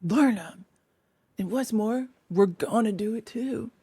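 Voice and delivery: soft little Mole, stubborn; voiced Mole. A narrator's reading. Mole siding against Rat, soft and stubborn. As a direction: insist softly.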